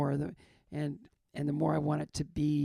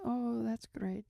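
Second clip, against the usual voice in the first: slow, higher imitation of a nurse; odd nurse; 5 semitones higher, much slower than usual. Nurse approves of patient's gratitude, imitated high and drawn out. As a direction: stretch it out as an oddly approving nurse. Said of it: "she had a weird energy to her".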